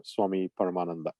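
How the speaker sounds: normal talking voice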